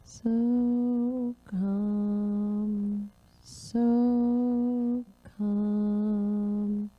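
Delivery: slow drawn-out meditative chant of so calm; calm breathing mantra for the room; guided mantra. Acted. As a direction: let each word float out on the breath.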